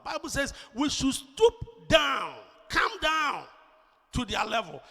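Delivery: high, drawn-out preacher's call; full-force preaching. Real, not acted. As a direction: drive it home from the pulpit.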